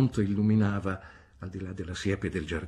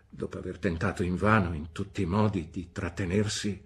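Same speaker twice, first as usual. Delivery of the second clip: usual speaking voice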